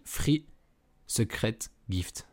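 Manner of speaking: slow English words in a French accent. Real, not acted. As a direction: read the English slowly with a real French accent. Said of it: "pas écouter mon accent anglais". (anglais lent avec un accent français)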